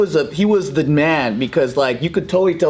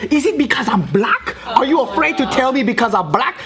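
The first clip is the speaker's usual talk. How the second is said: mock indignation, pitched up; accusatory; 6 semitones higher than usual. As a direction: imitate a woman confronting a bystander. Accusing woman, retold.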